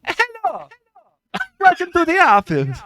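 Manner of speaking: squeaky cartoon voice. Cohost voicing Nintendo in a squeaky cartoon voice.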